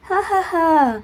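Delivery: a teasing ha ha ha; mock laugh. A narrator's reading. Conscience teasing Viraj, laughing. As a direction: laugh it off at him.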